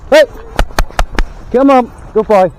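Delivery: loud, high come on and praise; calling the dog. Real, not acted. Owner praises and calls his dog, loud and high. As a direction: coax the dog along, pleased with him.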